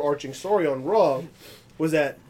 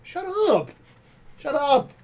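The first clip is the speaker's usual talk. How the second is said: high-pitched, incredulous dismissal; exasperated shut-up; 11 semitones higher than usual. Retold or imitated. Fan scolding a wrestler's nonsense, high exasperated dismissal. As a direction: squeal it in mock exasperation.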